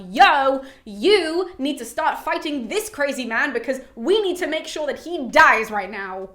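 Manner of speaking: loud mock yell with drawn-out key words; retold yelling. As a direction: call out an urgent order as the angry river. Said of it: "he is then yelling at this other river".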